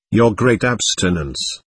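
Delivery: ordinary delivery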